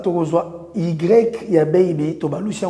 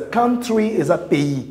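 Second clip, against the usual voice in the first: ordinary talk, nothing marked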